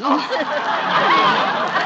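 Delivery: high peal of laughter. Acted. Wife laughing at her mother's mix-up, high laugh. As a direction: burst out laughing.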